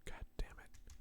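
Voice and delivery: hushed whispered aside; whispering. Real, not acted. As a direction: breathe it under your breath. Whispered aside before question.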